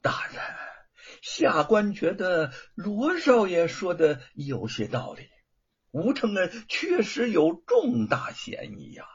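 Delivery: hint it slyly, dragging the words; sly suggestion. A narrator's reading. Magistrate slyly agrees with the son before the prefect. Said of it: "狡黠地说".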